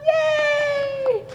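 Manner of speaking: very high, bright cheer; cheering yay. Real, not acted. Host cheers as the shy contestant takes the stage, excited. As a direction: celebrate him coming up.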